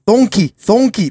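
"Donkey, donkey" as slow thonkey, thonkey; D turned to TH. Retold or imitated. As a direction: slowly imitate TH for D in "Donkey". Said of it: "closer to a T H".

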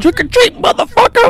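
high-pitched quoted catchphrase; put-on high voice; movie one-liner. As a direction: deliver a hammed-up catchphrase in a high voice. Quotes a film one-liner for laughs.

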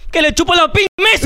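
high mocking yell; teasing shout. Real, not acted. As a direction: shout the punchline at him.

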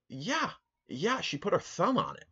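very high incredulous whine. Retold or imitated. Telling the guy she touched it, high incredulous whine. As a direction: pitch shoots up in disbelief.

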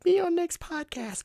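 a creepy high-pitched nag; weirdo pestering voice. Pretend. Pestering people to join the podcast, in a squeaky high voice. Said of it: "let me stop being a fucking weirdo".

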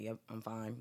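mock curt, clipped under his breath; a touch of a curt brother; curt and short. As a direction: answer short, shutting people out. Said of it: "very short with words".

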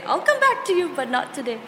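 sweetly fob someone off with a laugh; mock polite brush-off. Turning down a draining person in a sing-song voice.

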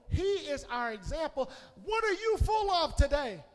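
full-throated Sunday sermon; preaching. Acted. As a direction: preach it to the congregation. Preacher building to full force.

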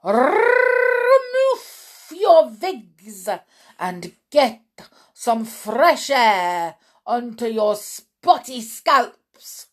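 loud, high, harsh witch's shout; shouted order. A narrator's reading. Grand High Witch shouting at the witches, loud harsh order. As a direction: bellow it at the whole room. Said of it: "she shouted".

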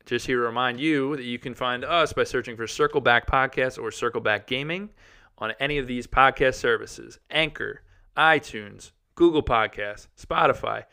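upbeat promo read. Acted. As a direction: read it like a podcast plug. Podcast outro ad read.

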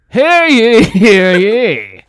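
loud, high town crier call. Pretend. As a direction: proclaim it like a medieval herald. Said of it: "How would the Saw Doll in medieval times ask to play a game?".

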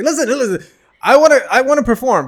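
high, loud, insistent begging; eager pleading. Retold or imitated. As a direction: imitate a keen hopeful pressing for a chance. Retells pleading to perform.

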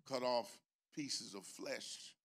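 normal talking voice

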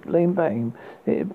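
usual speaking voice

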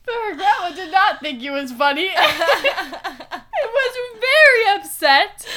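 loud words through real laughter; laughing hard. Real, not acted. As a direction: keep telling it while cracking up. Telling story while cracking up.

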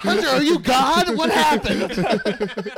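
high, loud, laughing through it; giddy amazed laughter; laughing disbelief. Retold or imitated.

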